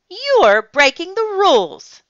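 high, loud indignant rebuke; outraged scolding. Acted. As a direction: sound outraged at the broken rule. Librarian scolds roaring lion.

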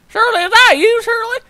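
very high, questioning caller. Acted. A caller asking for Shirley on the phone.

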